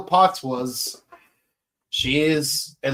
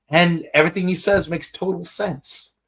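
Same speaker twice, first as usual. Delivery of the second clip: ordinary talk, nothing marked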